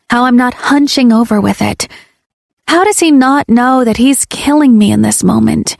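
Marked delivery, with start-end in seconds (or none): none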